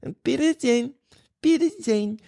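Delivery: put-on high-pitched little-kid voice with a cute accent; tiny, high imitation of a small child; toddler saying German goodbye. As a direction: squeak it out like a three-year-old trying German. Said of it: "he's got the little accent and stuff".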